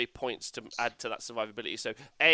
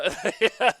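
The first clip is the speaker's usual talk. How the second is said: high burst of laughter; 7 semitones higher, 6 dB louder than usual. Real, not acted. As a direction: laugh out loud. Laughing at story end.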